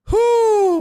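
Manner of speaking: loud, high whistle of a whew; excited whew. Real, not acted. Reacting to timing out Donna.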